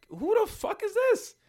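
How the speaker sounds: high, slow shocked yell; shocked outburst. Retold or imitated. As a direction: blurt it out in disbelief. Kid hears [name] screaming.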